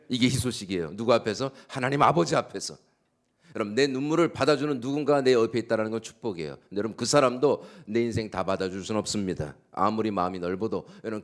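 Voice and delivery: high, loud, fervent preaching; preaching at full force. Acted. Pastor proclaiming good news to the congregation, fervent loud preaching.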